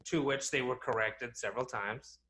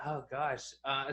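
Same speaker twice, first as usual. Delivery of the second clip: ordinary delivery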